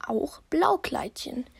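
geheimnisvoll geflüstert; flüstere es unheilvoll. A narrator's reading. Erzähler flüstert. (Erzähler flüstert die kaputte Tür)

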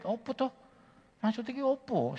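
high, baffled questions; what on earth do you mean; startled confusion. Retold or imitated. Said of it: "saya kan kaget". (bingung dan kaget)

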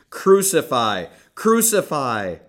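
loud, high frenzied chant; frenzied shout. Acted. Priests and police shouting at Pilate, frenzied yell. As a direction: roar it like a mob in a frenzy. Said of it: "they shouted in a frenzy".

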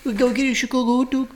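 laughing mock Chicago accent. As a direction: ham up a Chicago local. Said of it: "the fifth sexiest accent is Chicago".

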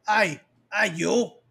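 lightly mocked fake Aussie brute; mock a failed Australian accent; Kano's bad accent. Podcaster imitating Kano's bad Australian accent, mock fake Aussie. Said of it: "it's not an Australian accent".

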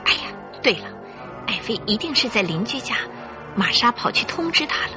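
ordinary talk, nothing marked

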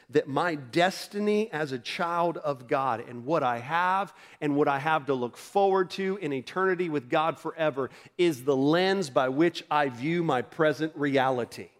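ordinary delivery

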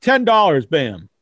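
ordinary talk, nothing marked

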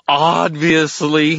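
OBVIOUSLY high, loud, very drawn out; condescending fairy. Acted. Fairy tells slow wizard obviously, high and stretched.

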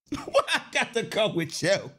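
chuckling as he repeats; laughing at his own question; laughing through it. Shown as a demonstration. Repeating the protest to the audience through laughter.

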